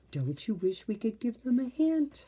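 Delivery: plain reading voice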